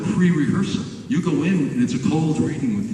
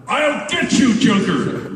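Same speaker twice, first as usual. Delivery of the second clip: a louder, higher take; 9 semitones higher, 4 dB louder, slower than usual. Shown as a demonstration. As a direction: raise the volume on the threat. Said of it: "Little louder".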